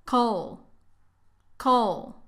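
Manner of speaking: Coal as a short ko; first syllable Coal. Shown as a demonstration. Teacher breaking chocolate down, short Coal. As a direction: clip the o in Coal. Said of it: "Aquí la O casi no tiene sonido".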